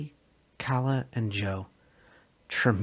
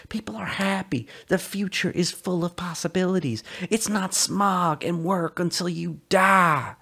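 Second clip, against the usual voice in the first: raised and slow, swelling with longing; Sully's pleading voice; 8 semitones higher, 5 dB louder than usual. Acted. Sully begging Calla to come away, slow raised pleading. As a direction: sell her a dream, begging.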